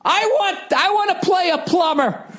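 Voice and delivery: very high, shouted put-on voice; hammed-up falsetto plea; mock whiny demand. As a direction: squeal the demand like a spoiled star. Mock actor's demand at Oscars.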